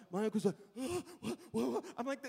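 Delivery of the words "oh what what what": imitated panicked uncle; mock speechless shock; freaking out. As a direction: stammer gasped, startled words. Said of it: "He's freaking out".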